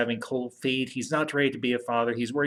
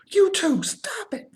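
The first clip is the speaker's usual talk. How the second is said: very high, strained telling-off; squeaky hushed reprimand; mock scolding; 4 dB louder, slower than usual. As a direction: imitate exasperated women breaking up a fight. Women break up the fight.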